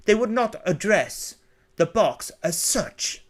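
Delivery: high, formal upper-class English voice; upper-class English butler. Acted. Butler turning away a caller, stiff upper-class English accent. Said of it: "An elegant upper-class English accent".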